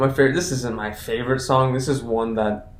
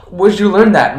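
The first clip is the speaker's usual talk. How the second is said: higher, louder scolding question; surprised babysitter; 6 semitones higher, 9 dB louder than usual. Retold or imitated. Babysitter to the child, higher and louder in surprise. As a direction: catch the kid out.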